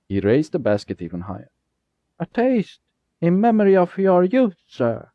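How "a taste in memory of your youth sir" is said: put-on high, sweet boyish offer; a boy luring the driver with a berry; sly boy. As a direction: lure him in with sweetness. Jasper offering berry, voiced boy.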